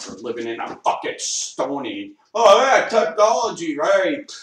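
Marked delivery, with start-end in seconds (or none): 2.3-4.3 sarcastic outburst. Real, not acted. Sarcastic rant about stone age.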